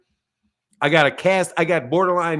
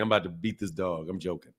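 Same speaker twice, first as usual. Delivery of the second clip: normal talking voice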